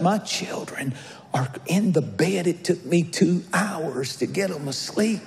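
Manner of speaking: put-on whispered, not to wake the kids; playing a neighbour hushing so the children sleep; hushed refusal. Sleepy friend refusing at midnight.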